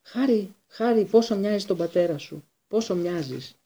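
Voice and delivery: high, wheedling appeal; pleading. A narrator's reading. Kneeling Pettigrew appeals to Harry.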